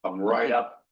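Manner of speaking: usual speaking voice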